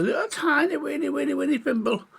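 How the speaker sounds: high, quick little squeak of a voice; high-pitched storybook voice for something minuscule; teeny-tiny voice. A narrator's reading. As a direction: make the words sound tiny, pitched right up. Narrator acts out tininess of thimble.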